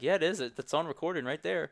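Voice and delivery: high, loud matter-of-fact rebuttal; insistent confirmation. Retold or imitated. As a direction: insist on it to a doubter. Imagined answer to his own disbelief.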